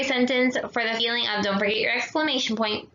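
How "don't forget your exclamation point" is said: normal talking voice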